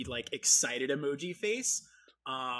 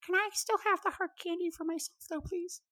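little bird whisper. Acted. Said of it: "kinda whispers in their ear".

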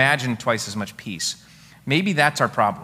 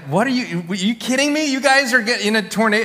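higher, louder shocked parent; alarmed disbelief; 10 semitones higher, 5 dB louder than usual. Retold or imitated. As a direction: hear your son is in a tornado. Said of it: "and it's like".

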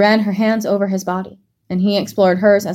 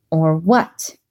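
defiant snap; 3 semitones lower than usual. A narrator's reading. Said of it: "she snapped".

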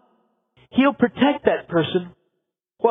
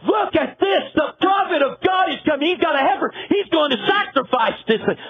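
excited shouting; 5 semitones higher than usual. Retold or imitated. Bethlehem elders calling to each other, excited shouts. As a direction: shout it like townsfolk spotting a celebrity. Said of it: "they go".

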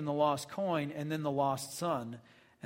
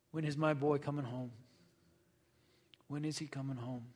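soft, yearning wonder from a hopeful father; longing father; 2 semitones lower, 7 dB quieter than usual. Retold or imitated. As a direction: ache quietly for the son to return. Father longing for lost son.

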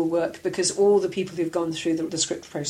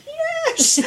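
very high, loud squeal of delight; re-enacted jubilant high yelp; triumphant cheer; 5 dB louder than usual. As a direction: celebrate the casting news with a squeal. Retells delighted reaction to casting.

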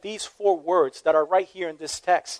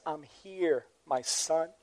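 hushed and tender, almost under the breath; soft, close father's reassurance; 4 semitones lower, 16 dB quieter, slower than usual. A narrator's reading. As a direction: say it softly into his ear like a father. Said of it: "Jim says softly".

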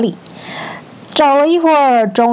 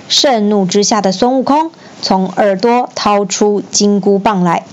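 usual speaking voice

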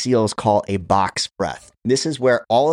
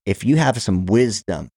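ordinary talk, nothing marked

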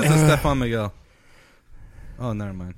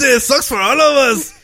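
Stefan Miguel voice; 7 semitones higher, 4 dB louder than usual. Retold or imitated.

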